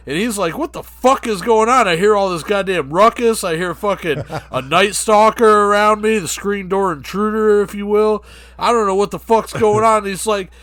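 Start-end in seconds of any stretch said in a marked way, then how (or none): 0.6-10.0 raised, higher, baffled complaint; mock agitation at all the racket. Acting [name] in a comic story.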